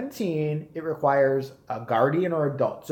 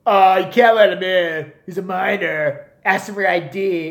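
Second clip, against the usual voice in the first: louder, slow officious manager voice; bossy manager; 3 semitones higher, 8 dB louder than usual. Retold or imitated. Teen manager telling girl to refuse him, bossy slow. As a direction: lay down the rule like a petty boss.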